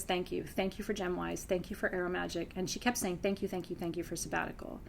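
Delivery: lightly voiced spirit, quiet and grateful; hushed spirit voice. Teacher quoting Mother Ayahuasca's thanks to her students, softly. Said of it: "the first thing she said in Journey".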